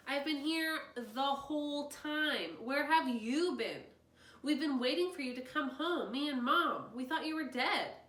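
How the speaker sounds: hurt outburst. A narrator's reading. Tobin confronts his missing dad, hurt and loud. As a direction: let it all out.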